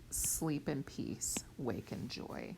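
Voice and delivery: whispered blessing, slow and low; soft whisper. Shown as a demonstration. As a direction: breathe it like a benediction. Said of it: "I'm sorry, that should be blue".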